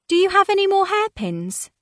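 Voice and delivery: put-on high, light girlish voice; lightly voiced young debutante; young lady voice. A narrator's reading. Livy asks, character voice.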